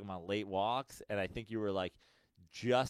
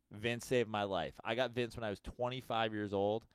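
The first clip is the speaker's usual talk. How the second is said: ordinary talk, nothing marked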